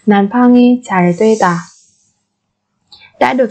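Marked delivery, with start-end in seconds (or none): 0.1-1.6 난방이 잘 되다 said quickly; fast native repeat. Shown as a demonstration. Teacher gives the fluent native-speed version, brisk. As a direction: rattle off 난방이 잘 되다.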